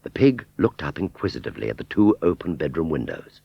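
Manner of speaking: ordinary delivery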